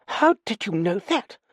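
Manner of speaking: high-pitched, astonished demand. A narrator's reading. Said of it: "He demanded".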